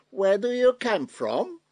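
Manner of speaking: high, loud, pointed English question; accusing question. Retold or imitated. Native speaker accusing an immigrant of being foreign, pointed. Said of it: "beschuldigend vragen".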